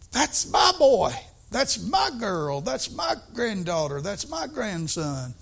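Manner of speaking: slow, fond parental pride, easing down as it goes; a proud grandparent in the stands. Retold or imitated. Proud parent cheering child on.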